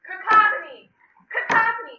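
cacophony firmly corrected, high and loud; corrected pronunciation. Shown as a demonstration. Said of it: "it's cacophony".

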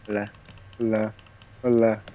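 ordinary talk

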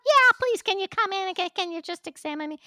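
high, loud, overeager begging; hammed-up pleading to the doctor's office; eager patient on the phone. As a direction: plead with the receptionist in a breathless high voice.

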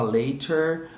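British "later" with a crisp t; British pronunciation. Shown as a demonstration. Teacher shows what the British prefer, British crisp-t "later". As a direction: say "later" the British way, t fully sounded. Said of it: "o britânico gosta mais de falar".

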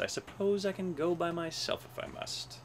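disgusted. A narrator's reading. Elephant deserted by his friends, mildly disgusted. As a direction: say it with put-upon resignation. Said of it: "said with a look of disgust".

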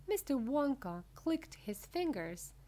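usual speaking voice

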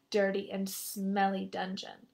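usual speaking voice

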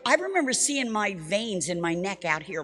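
plain reading voice

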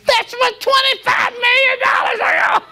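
high crying-out shout with laughter; overwhelmed outcry. Real, not acted. Preacher realizing the seed became millions, crying out. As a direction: cry out in stunned joy and laugh.

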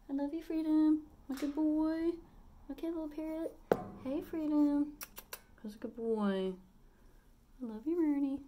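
high, tender cooing to the bird; telling her parrot she loves him in a high pet voice; high loving pet voice. Real, not acted. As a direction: coo your love up high.

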